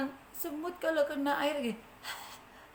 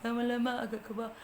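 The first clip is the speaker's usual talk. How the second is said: ordinary delivery, nothing marked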